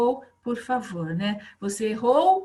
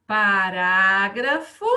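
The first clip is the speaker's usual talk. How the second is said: slow dictation; 2 semitones lower, 7 dB louder, much slower than usual. Shown as a demonstration. Teacher dictating punctuation marks.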